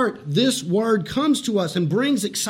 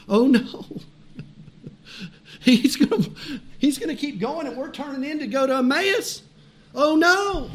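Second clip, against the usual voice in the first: lightly acted worried disciples; higher-pitched pretend worry; mock dismay; 5 semitones higher than usual. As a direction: play at being alarmed, voice rising at the end.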